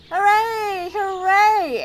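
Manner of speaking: high, loud cheering hooray; cheering crowd. A narrator's reading. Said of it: "Everyone cheered".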